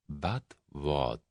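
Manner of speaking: dangerous quiet. A narrator's reading. Mysterious leader cutting off the German, dangerously quiet. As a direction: cut him off with quiet threat. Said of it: "a dangerous quality".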